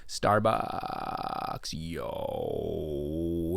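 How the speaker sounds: deep creaky vocal fry. Shown as a demonstration. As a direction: croak it low with fry. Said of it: "It's all that vocal fry".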